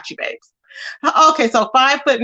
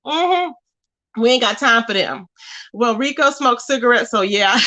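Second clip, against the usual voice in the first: ordinary talk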